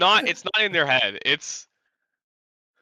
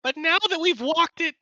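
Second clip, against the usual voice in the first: goblin voice; 13 semitones higher than usual. Acted. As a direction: speak as a grand goblin storm caller. Plays goblin Gobnarl telepathically.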